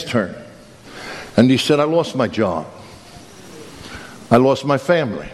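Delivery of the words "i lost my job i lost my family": low, subdued confession of loss; understated despair. Acted. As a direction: list each loss in a low, defeated voice. Despairing man lists his losses.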